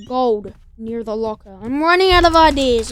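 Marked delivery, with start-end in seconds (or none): 1.6-2.9 loud, fast, frustrated; exasperated outburst. Real, not acted. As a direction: blurt it out in frustration. Exclaims, then repeats.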